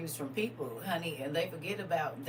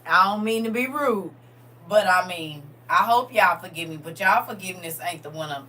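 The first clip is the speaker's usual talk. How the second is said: much louder, emphatic plea; preaching loud; 3 semitones higher, 11 dB louder than usual. Acted. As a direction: push it out to the crowd.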